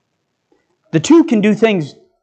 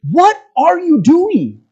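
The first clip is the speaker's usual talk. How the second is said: much higher and slower, questioning hard; pleading; 10 semitones higher, slower than usual. Real, not acted. Preacher pressing parents about Bible reading, high and slow. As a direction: plead with them to answer.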